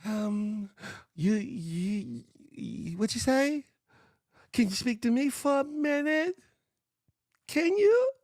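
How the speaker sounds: high thin imitation, rising at the end; high trembling voice. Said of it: "he has that Cat Williams voice".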